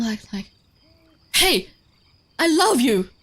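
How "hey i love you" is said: loud, high telling-off; mock scolding. Shown as a demonstration. Showing viewers the other way round, loud mock scolding. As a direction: scold the dog with loving words. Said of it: "you can go the other way round".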